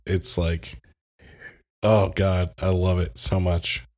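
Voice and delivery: ordinary talk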